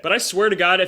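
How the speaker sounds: ordinary delivery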